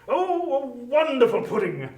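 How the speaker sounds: bright, high burst of praise; delighted exclamation. Acted. Bob praising his wife's pudding, delighted. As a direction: gush over the pudding.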